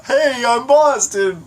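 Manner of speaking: high, slow, exaggerated Boston greeting; Boston caricature; hammed-up Boston accent. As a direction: drawl out a silly fake Boston accent. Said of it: "So light on an accent".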